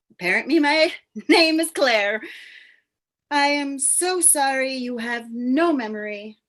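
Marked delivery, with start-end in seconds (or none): none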